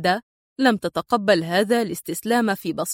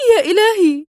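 high, loud gasp of horror; panicked cry; 8 semitones higher, 5 dB louder than usual. A narrator's reading. Woman seeing her face in the mirror, panicked cry. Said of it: "شهقت هاتفة في ذعر".

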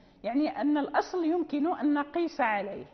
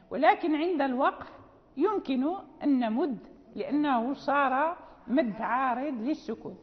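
usual speaking voice

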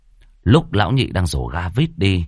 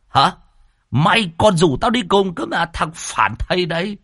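put-on furious old master; 9 semitones higher than usual. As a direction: roar threats at the traitor.

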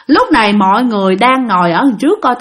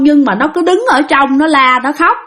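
usual speaking voice

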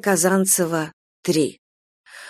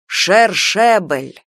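«Шершебель» slowly, each syllable relished; savoring the word; 3 dB louder, much slower than usual. Shown as a demonstration. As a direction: say «Шершебель» slow and louder, tasting it. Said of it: "вслушайся". («Шершебель» медленно, смакуя)

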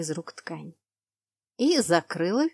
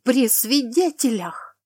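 loud, higher, drawn-out outrage; appalled, raised-voice accusation; furious indignation; 5 semitones higher, 6 dB louder, slower than usual. A narrator's reading. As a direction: hammer it out slowly, scandalized. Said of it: "сжимая кулаки".

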